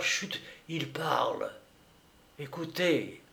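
soft, urgent shushing; hushed. A narrator's reading. Husband eavesdropping at the door, hushed. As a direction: drop the voice to listen.

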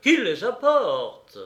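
loud, high, slow shout; crying out. A narrator's reading. Mercenaries clamouring for the general's cups, drawn-out shout. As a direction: call out as a clamouring crowd. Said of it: "ils s'écrièrent".

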